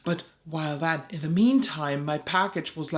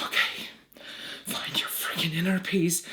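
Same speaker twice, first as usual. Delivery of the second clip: sighed, whispery self-talk; strained self-calming. Acted. Caller reminding herself of inner peace, strained whisper. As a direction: breathe out, then whisper it through frustration.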